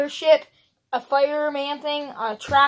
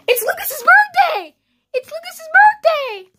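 high and slow, in a put-on squeaky voice; squeaky high announcer; 13 semitones higher, 6 dB louder than usual. Big brother squeals the birthday news to the camera in a high voice.

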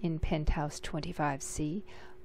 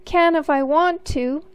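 higher, louder bratty retort; defiant little brother; 8 semitones higher, 8 dB louder than usual. Acted. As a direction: stick out your chin and argue like a small boy. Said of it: "he said".

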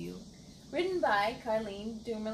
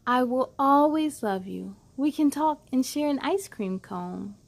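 louder, expressive picture book reading; reading to children; 10 dB louder than usual. Acted. Host reading a loving picture book to kids, expressive and louder. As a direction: read it out like a bedtime story for little ones.

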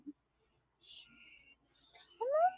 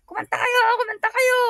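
mock coach yell, raised; calling out like the coach; 6 semitones higher, 5 dB louder than usual. Retelling the coach shouting at singers, mock yell. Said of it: "si Coach Angel sumisigaw".

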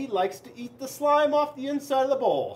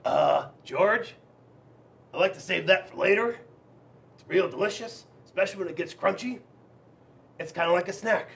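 a hint of a lazy snail, lower at the start; lightly voiced snail savouring his slime; Melvin the snail; 3 semitones lower than usual. Snail tells George he saves the gunk, lightly voiced. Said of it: "Melvin said".

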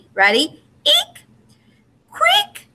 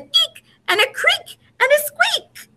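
high, squeaky voice for the little noises. A narrator's reading. Reader to children, squeaking the mouse noises high. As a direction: make it sound like tiny mice.

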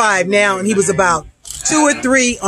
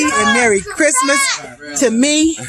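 sing-song delight; 8 semitones higher, slower than usual. Real, not acted. Happy holiday wish to herself.

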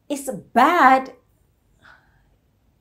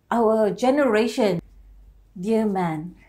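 ordinary talk

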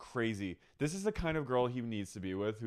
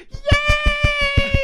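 squealy impression; 13 dB louder than usual. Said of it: "And then she goes".

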